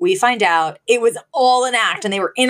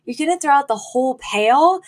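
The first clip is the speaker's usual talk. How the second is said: mock aghast child; 6 semitones higher than usual. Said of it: "Gracie's like".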